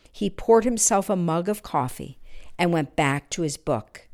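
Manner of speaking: usual speaking voice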